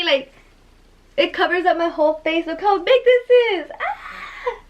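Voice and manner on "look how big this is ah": high, louder exclamation into a squeal; excited squealing. Real, not acted. Showing viewers the big palette, excited squeal. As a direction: marvel in a high, giddy voice.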